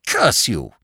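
a lightly voiced playful curse; mock curse. Lord Peter mock-cursing Parker, light and playful. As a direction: curse him half in jest.

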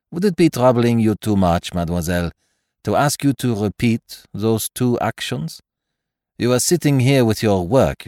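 a hint of a polite French detective; lightly voiced courteous Belgian; Poirot's voice. Poirot voice held.

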